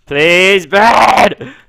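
high, loud, drawn-out begging yell; loud pleading. Real, not acted. Player begging for a bed after failed command, loud plea. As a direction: beg the game at full volume.

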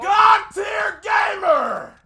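screamed high and drawn out; hype shout. Real, not acted. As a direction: belt it like a hype announcer. Teammate clutch, mock hype.

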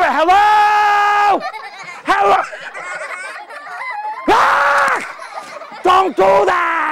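hammed-up startled yelps; mock fright. Magician pretends to be startled again.

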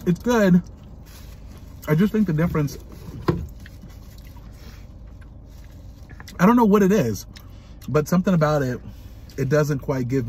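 chewing while talking; speaking between bites; mouth full of food. Real, not acted. Seen 0: Holds burger at lips, bites at 5.6, jaw chewing throughout.

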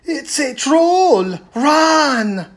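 high, loud, slow panicked cry; scared shout. A narrator's reading. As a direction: yell in terror at the troll. Girls spot troll and flee.

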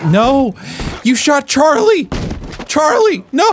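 high, loud cry over the shot horse; anguished cry. Real, not acted. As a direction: shout in shock and grief.

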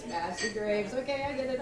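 ordinary delivery

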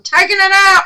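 high, loud, gleeful announcement; loud declaration. Real, not acted. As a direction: announce it boldly to viewers. Repeating it loud to viewers.

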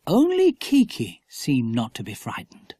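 plain reading voice

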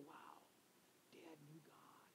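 hushed, breathless wonder; awed whisper. Retold or imitated. Children whispering in awe over their father's Bible. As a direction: whisper it like finding a treasure. Kids imagining reading his Bible.

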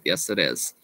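ordinary talk

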